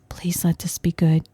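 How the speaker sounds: soft whispered plea, imitating her son; retold whisper. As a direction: breathe it out like a nervous hopeful child. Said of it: "He's whispering it".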